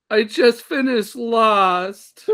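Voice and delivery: high, slow laughing imitation of a crying teen; mock crying. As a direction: wail it through fake sobs. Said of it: "and she's crying".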